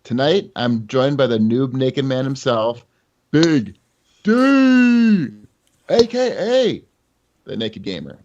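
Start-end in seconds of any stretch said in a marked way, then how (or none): 3.3-8.2 drawn-out high announcer call; hype announcer. Acted. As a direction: announce him like a fight. Hyped announcer introduction.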